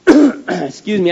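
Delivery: hoarse, slow through a cough; clearing a cough mid-sentence; coughing fit. Real, not acted. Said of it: "I've been coughing all week".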